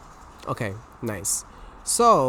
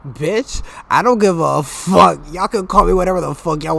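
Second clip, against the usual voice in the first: defiant outburst; 4 semitones higher, 7 dB louder than usual. Real, not acted.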